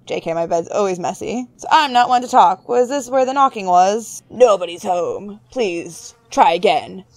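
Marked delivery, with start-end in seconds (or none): 4.3-7.0 low, slow mock message voice; mock-official low brush-off; put-on turn-away message. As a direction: answer the knock in a put-on low voice. Mock answer to the knocking.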